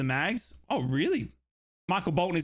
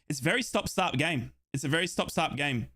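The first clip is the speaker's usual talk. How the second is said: ordinary talk, nothing marked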